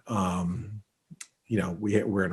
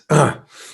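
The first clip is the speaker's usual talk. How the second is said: throat clearing; 7 semitones higher, 8 dB louder than usual. Real, not acted. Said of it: "Excuse me, I gotta sneeze".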